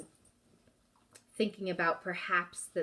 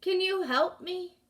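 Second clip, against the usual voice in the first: pleading; 12 semitones higher, 6 dB louder than usual. Acted. Trapped voice calls for help.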